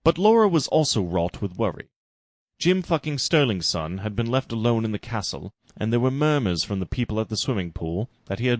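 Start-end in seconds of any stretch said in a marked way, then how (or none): none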